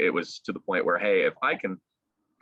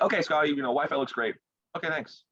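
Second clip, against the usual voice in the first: higher-pitched mock crew sign-off; lightly put-on, higher voice of a tech checker; imitating the crew; 7 semitones higher than usual. Crew member to finalist after the Wi-Fi check, imitated breezy sign-off. Voicing the crew's cheery check.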